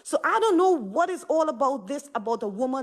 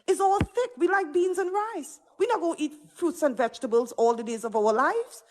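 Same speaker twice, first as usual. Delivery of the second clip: ordinary talk, nothing marked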